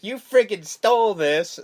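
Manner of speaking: a hint of a scolding trashy girlfriend; accusing girlfriend. Girlfriend accusing boyfriend of stealing the truck. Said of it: "She's like".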